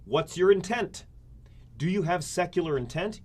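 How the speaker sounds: pointed question voiced for the test; test asking. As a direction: quiz them like the test itself. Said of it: "The first question it asks is".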